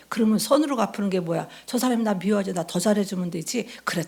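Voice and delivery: ordinary delivery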